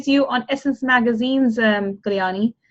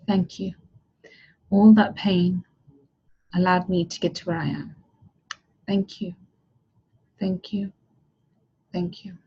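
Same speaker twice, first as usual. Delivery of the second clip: softer with each thank you, almost gone by the end; grateful prayer fading; 3 semitones lower, 3 dB quieter than usual. Acted. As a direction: let the thanks dissolve into silence. Visualized gratitude prayer fading out.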